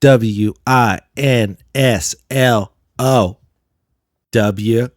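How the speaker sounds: slow, letter by letter; real slow. Shown as a demonstration. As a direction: spell it out very slowly. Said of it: "break it down to you real slow".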